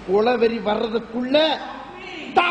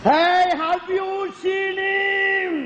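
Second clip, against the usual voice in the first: drawn-out, higher shout that opens on a called "Hey"; slow shout; 7 semitones higher, much slower than usual. Acted. Man searching for someone hollers slowly at a silent bystander. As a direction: call out slowly to get their attention.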